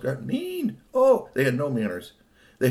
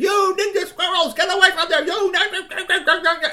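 very high, loud screeching scold; furious yelling; 8 dB louder than usual. Acted. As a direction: yell at the squirrels to scare them off. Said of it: "he started yelling at them".

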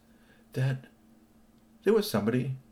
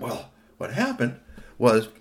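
ordinary delivery, nothing marked

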